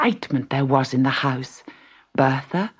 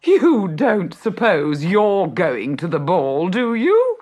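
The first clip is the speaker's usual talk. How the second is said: mocking stepmother; 4 semitones higher, 4 dB louder, slower than usual.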